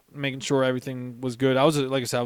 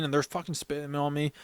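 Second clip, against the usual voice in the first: normal talking voice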